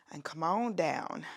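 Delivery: playfully put-on American accent; hammy, amateur American accent; bad American accent. Instructor cueing a lunge in a jokey bad American accent. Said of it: "do a really bad American accent".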